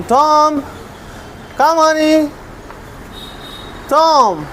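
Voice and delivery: high, drawn-out pet call; coaxing call, pitched well up. Real, not acted. Calling a missing pet to come.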